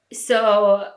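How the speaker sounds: ordinary talk, nothing marked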